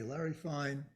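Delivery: usual speaking voice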